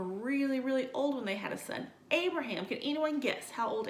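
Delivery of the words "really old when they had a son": ordinary talk, nothing marked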